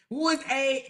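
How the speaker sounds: ordinary delivery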